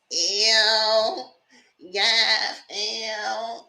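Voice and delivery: high drawn-out "ew"; imitated stripper squeal. Stripper on her face, mock squealed ew. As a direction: stretch each word into a high little whine.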